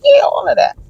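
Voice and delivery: eager high squeal. Retold or imitated. Retold eager answer.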